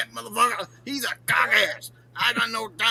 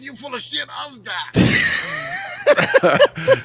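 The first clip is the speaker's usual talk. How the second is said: high, slow, louder shout; mocking high drawn-out shout; roasting yell; 14 semitones higher, 6 dB louder, much slower than usual. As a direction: drag out the insult at full volume. Roasting a chatter.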